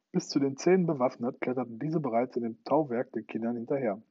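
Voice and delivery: plain reading voice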